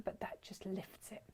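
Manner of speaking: soft breathy whisper. Real, not acted. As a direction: hush it like a secret. Admiring the shade.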